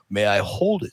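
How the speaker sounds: low, imitated movie-star request; Sly Stallone. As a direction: ask low, as the admiring actor. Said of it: "When Sly saw it".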